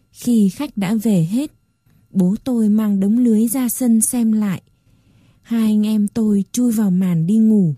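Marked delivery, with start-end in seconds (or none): none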